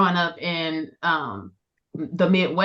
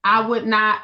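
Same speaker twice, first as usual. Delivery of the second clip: normal talking voice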